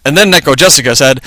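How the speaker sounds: plain reading voice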